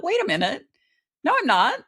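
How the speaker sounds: wait-a-minute defiance; sudden realization. Retold or imitated. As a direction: catch yourself and push back, loud and high. Sudden inner realization retold.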